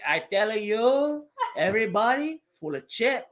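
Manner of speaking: a hint of a Cuban grandma grumbling; Cuban grandma accent. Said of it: "that's how she says shit".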